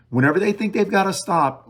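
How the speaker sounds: normal talking voice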